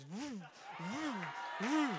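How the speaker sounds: quick rising whoosh of a rod; imitated fishing rod. The rod goes off mid-prayer, imitated whooshing bursts. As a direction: voice the line whizzing out in short bursts. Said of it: "the guy's rod goes".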